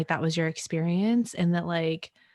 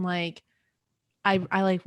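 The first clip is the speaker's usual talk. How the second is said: ordinary delivery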